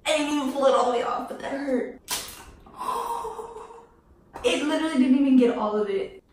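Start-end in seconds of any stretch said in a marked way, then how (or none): 2.0-3.8 long high gasp of pain; sharp pained gasp. Real, not acted. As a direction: suck in air through the sting. Wax strip hurt.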